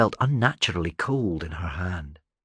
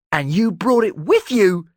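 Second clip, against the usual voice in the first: high, loud accusing yell; 12 semitones higher, 7 dB louder than usual. Acted. Talon yelling at Erasmus over the relic. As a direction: shout it in disbelief. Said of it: "yelled Talon".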